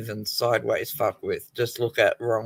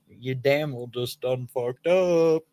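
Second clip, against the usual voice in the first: mock teasing kids; slower than usual. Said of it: "they just looked at me and went".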